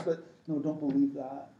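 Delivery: usual speaking voice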